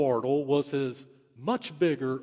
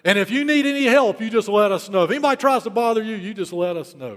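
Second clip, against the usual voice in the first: put-on teens calling after; 8 semitones higher, 9 dB louder than usual. Teens call after the boy.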